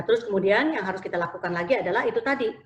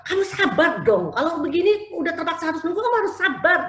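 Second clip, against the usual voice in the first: mother losing patience, voice climbing higher; impatient parent; 8 semitones higher, 4 dB louder than usual. Retold or imitated. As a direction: nag the child to be patient with no patience yourself. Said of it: "kalau kita lihat dari nadanya, orang tuanya sendiri juga nggak sabar".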